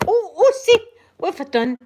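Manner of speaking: high, loud laughing panic; laughing exclamations over a mess she made; flustered laughter. Real, not acted. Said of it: "I'm a terrible, terrible host".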